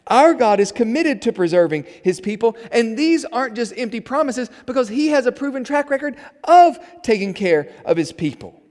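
loud, high-pitched preaching; full-force preaching. Real, not acted. Preacher assures congregation of God's care, preaching forcefully. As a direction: proclaim it loudly to the congregation.

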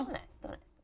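soft whispered agreement. Real, not acted. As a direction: whisper it back.

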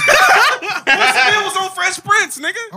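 high, slow laughing jab; laughing through words. Real, not acted. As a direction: crack up while delivering it. Laughing punchline.

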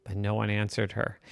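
ordinary delivery

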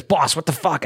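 high-pitched mock outrage; retold indignant complaint. Donnie coming off stage to his boss, high indignant complaint. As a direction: imitate a booed performer's indignant protest. Upset performer comes off stage.

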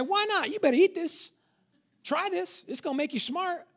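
high-pitched coaxing tempter; tempting second voice. Acted. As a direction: coax in a high, put-on voice. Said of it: "The second voice came and said".

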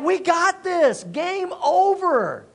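high, gloating trash talk; cocky prophets. Acted. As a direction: crow as if victory is already yours. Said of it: "They're like".

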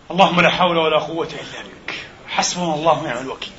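ordinary talk

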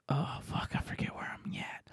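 whisper. Retold or imitated. Retold embarrassed aside in Canada.

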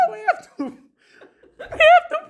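very high, laughing squeal; panicked laughing shriek. Real, not acted.

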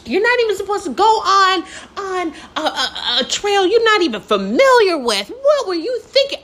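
high-pitched mock scolding; yelling lecture. Sister lecturing the lost hiker, high and scolding. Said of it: "yelling, talking about".

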